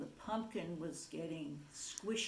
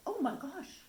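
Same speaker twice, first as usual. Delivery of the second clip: higher, gasping little turtle voice; spooked turtle exclaiming; a hint of fright; surprised turtle; 8 semitones higher than usual. Turtle reacts to jack-o'-lantern.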